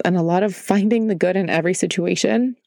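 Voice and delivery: ordinary delivery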